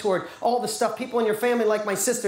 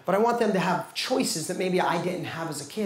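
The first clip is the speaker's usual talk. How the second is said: usual speaking voice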